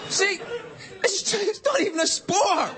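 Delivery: high-pitched comic indignation; exasperated, voice strained way up high; exasperated protest. Acted. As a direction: protest as if it's utterly absurd. Ranting about extreme sports.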